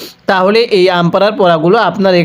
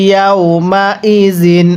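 slow recitation; slower than usual. Shown as a demonstration. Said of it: "আমার সাথে পড়ুন".

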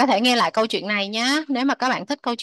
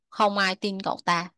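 usual speaking voice

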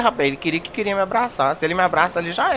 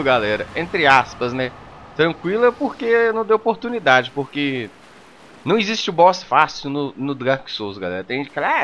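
usual speaking voice

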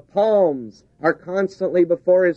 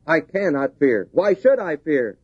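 ordinary delivery

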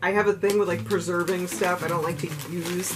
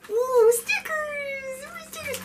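very high, long-drawn squeal; excited squeal. Real, not acted. Sticker collector unwrapping more, excited squeal. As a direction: squeal it, fading to a tiny squeak. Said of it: "I'm really excited to get these stickers".

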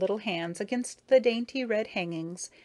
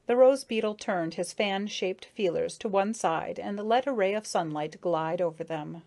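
plain reading voice

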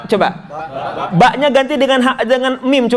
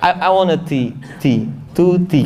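English accent, breathy t on "tea"; English-style t; 5 semitones lower than usual. Shown as a demonstration. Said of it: "kayak English gitu loh".

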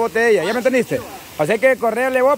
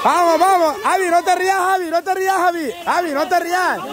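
high, insistent sideline chant; urgent repeated urging; 5 semitones higher, much faster than usual. Real, not acted. Host tells a giggling player not to laugh, excited urging. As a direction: keep pressing the player over and over.

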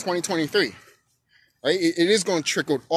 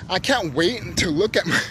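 usual speaking voice

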